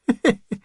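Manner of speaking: short high chuckle of disbelief; a scoffing laugh at a stranger; disbelieving chuckle. Acted. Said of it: "chuckled in disbelief".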